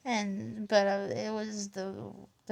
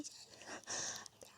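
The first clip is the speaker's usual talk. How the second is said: whisper; 22 dB quieter, much faster than usual. Real, not acted. Whispered prayer-like ending.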